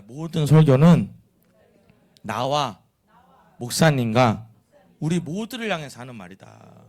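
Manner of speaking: slow, loud, phrase-by-phrase declaration; feed it out firmly in low, measured chunks; lead a recitation. Shown as a demonstration. Preacher leading congregation to repeat after them, slowly and loudly. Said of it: "여러분, 따라해봐요".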